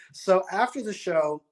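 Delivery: usual speaking voice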